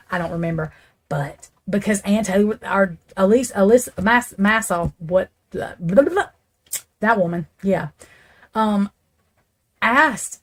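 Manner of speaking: usual speaking voice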